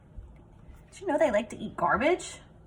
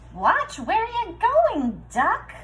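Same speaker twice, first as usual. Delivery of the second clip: high, louder, slow fretful hen; nervous hen; 10 semitones higher, 5 dB louder, slower than usual. Acted. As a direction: fuss it out high like a worried hen. Fearful hen's thought.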